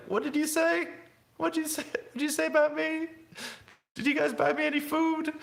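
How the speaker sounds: put-on squeaky child calling to the front seats; high whiny kid voice. Child in back of car shouting.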